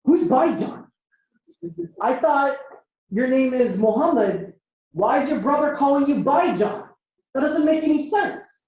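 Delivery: put-on confused kid. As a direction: play a baffled kid with pauses between thoughts. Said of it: "So he's confused. He's like".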